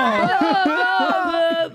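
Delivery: shocked laughter. Real, not acted. Laughing after the twist.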